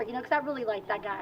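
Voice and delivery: ordinary talk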